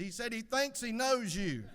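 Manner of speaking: loud, high punchline delivery; loud relay. Acted. Husband passes the attendant's remark to his wife, loud and high. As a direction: call it out for deaf ears.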